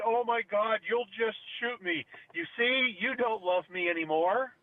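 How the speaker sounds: high, drawn-out mock hurt; hammed-up hurt girlfriend; wounded ex. Acting out the ex's wounded complaint.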